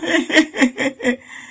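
high, loud giggle; a little puppet laughing at himself; high burst of puppet laughter. A narrator's reading. Puppet laughing at his tale.

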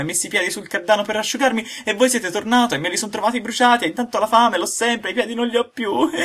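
put-on high, bright-eyed wooden boy pouring out his story; a hungry puppet complaining; high puppet voice, loud and eager. A narrator's reading. Pinocchio's voice telling his night.

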